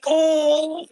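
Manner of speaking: ordinary talk, nothing marked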